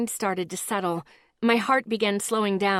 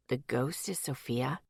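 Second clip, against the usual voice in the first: man's voice; 7 semitones lower, 9 dB quieter than usual. A narrator's reading. Miles shocked.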